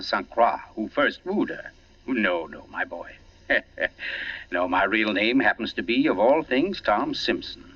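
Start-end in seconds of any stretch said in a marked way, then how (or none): 3.5-4.5 a quiet amused chuckle under the breath; little laugh, almost silent; soft chuckle. Acted. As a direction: chuckle softly at the mistake. Detective amused at a mistaken name.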